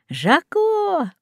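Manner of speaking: high call of the parrot's name. A narrator's reading. Guest calls his parrot by name, a high call. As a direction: call the bird over, sharply. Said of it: "обратился он к попугаю".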